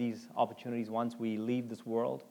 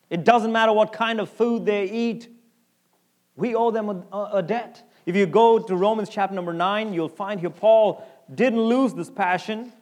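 preaching at full force; 7 semitones higher, 9 dB louder than usual. Acted. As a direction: hammer each line home to the church. Preacher building to a climax.

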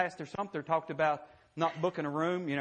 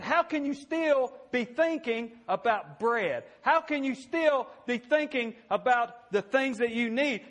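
exasperated Jesus; 6 semitones higher, 4 dB louder than usual. Retold or imitated. Preacher voicing Jesus asking the disciples about bread, exasperated. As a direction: drag out the disbelief. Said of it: "He said".

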